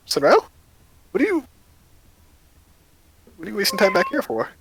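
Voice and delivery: a hint of an annoyed boss calling out; lightly voiced impatient boss. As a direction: call her back to work. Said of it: "the owner pokes his head out".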